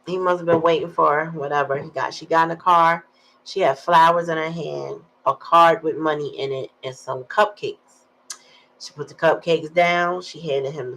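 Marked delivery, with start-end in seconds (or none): none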